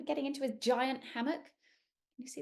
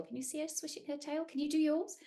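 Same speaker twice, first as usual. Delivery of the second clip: ordinary delivery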